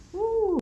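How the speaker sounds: excited woo; bright rising whoop; high whoop. Real, not acted. Whoops heading into snow.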